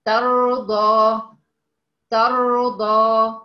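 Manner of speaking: teacher's clear recitation of ترضى; tajweed demo. Shown as a demonstration. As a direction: model ترضى slowly, raa full, taa not طا. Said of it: "ما تقوليش طا".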